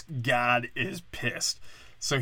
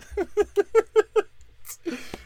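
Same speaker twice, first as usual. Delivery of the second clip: loud high giggling; giggling at the Veggie Tales idea; high laugh; 4 dB louder than usual. Real, not acted. Laughs at own joke.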